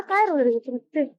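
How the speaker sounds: normal talking voice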